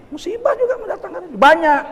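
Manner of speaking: restarts the line high, laughing. Shown as a demonstration. Preacher laughs at his own slip before the congregation, laughing. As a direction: breaks into laughter mid-phrase.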